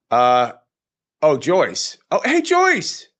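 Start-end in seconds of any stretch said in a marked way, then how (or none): none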